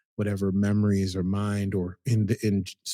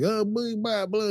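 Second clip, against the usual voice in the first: higher, dazed babble; dazed mumbling; 10 semitones higher than usual. Retold or imitated. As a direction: babble like a disoriented man. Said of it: "then he's like".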